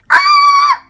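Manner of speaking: piercing high imitated screech; car screech imitation. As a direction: shriek like a speeding car.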